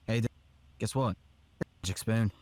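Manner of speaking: lightly done British accent; a casual attempt at a British accent. Shown as a demonstration. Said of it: "do a British accent".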